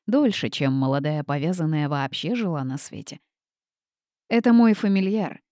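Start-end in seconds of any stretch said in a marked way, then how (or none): none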